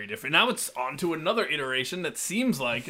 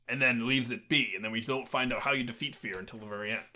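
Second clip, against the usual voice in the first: ordinary talk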